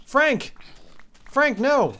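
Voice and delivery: loud, high cries for Frank; alarmed yell. Real, not acted. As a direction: shout in panic at the pet. Pet dying in game.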